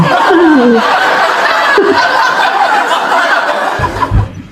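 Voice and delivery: long, high-pitched mocking cackle; mocking laugh. Real, not acted. Mocks fear of handcuffs. (tawa mengejek)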